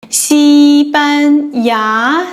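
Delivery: 西班牙 stretched out slowly and clearly; very slow model word. Shown as a demonstration. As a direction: model the country name very slowly. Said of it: "For instance".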